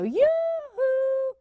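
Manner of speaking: high, lilting call across the driveway; sing-song yoo-hoo. Retold or imitated. Aunt calling her nephew from the driveway, high sing-song. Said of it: "I would go".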